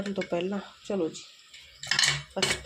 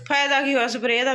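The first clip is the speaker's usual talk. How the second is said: calling out; 5 semitones higher, 9 dB louder than usual. Real, not acted. Calling across to family.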